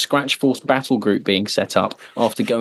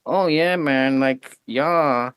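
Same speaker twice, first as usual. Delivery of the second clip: higher, laid-back hippie tank commander; lightly voiced laid-back hippie giving it all that spiel; Oddball's spiel; 4 semitones higher, much slower than usual. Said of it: "and he's like".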